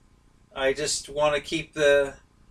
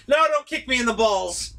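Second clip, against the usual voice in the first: laughing plea; 6 semitones higher than usual. Real, not acted. Playful plea while laughing.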